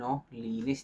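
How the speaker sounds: normal talking voice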